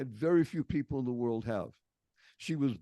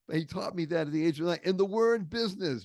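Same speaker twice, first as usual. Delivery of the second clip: ordinary talk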